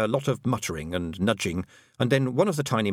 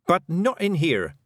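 higher-pitched, emphatic rebuke; angry snap; 8 semitones higher, 3 dB louder, slower than usual. A narrator's reading. Girl scolding the men in her dairy, angry. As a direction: snap at them crossly, weighting the words. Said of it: "snapped Tiffany, shaking with anger".

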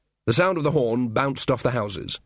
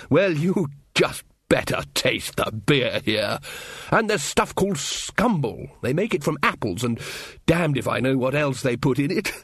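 put-on enthusing old wizard; 3 semitones higher than usual. Ridcully urging local drinks on his companions, enthusiastic relish. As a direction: savour it, then press it on them.

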